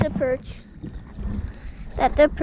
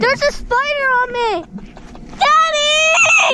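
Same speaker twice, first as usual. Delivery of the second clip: screaming at a spider; 8 semitones higher than usual. Real, not acted. Kid panics at spider on him.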